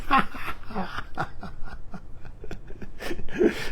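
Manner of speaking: soft breathy laugh trailing off; amused wheezing laugh; long quiet laugh. Real, not acted. Long laugh after the dare.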